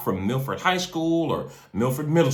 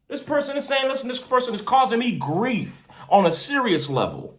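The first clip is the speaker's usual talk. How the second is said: a street preacher rallying believers; preaching; 12 semitones higher than usual. Acted. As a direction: preach it with full conviction to the congregation. Preaching continues.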